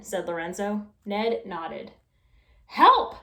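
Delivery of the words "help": high, loud cry for help; trapped couple crying out for help; shouted for help. A narrator's reading. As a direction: call out desperately. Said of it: "shouted a faraway voice".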